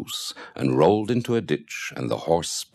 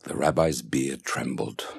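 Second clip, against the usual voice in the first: ordinary delivery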